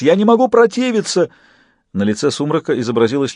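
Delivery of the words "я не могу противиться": high-pitched helpless howl; hammed-up, high cry of surrender; wailing. A narrator's reading. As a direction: wail in overdone helplessness. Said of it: "завыл паяц".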